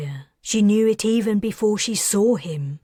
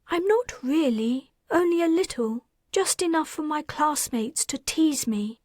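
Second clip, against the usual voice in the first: put-on lightly higher girl's voice, content; a happy little girl, pitched up; young girl Mickey; 9 semitones higher than usual. A narrator's reading.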